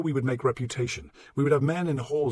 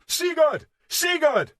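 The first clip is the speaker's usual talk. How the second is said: very high, loud battle bellow; all-out rallying yell, pitched way up; bellowed war cry; 8 dB louder, much slower than usual. A narrator's reading. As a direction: bellow the name over the fighting. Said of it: "bellowed our war cry even louder".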